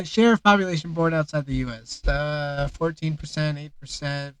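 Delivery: normal talking voice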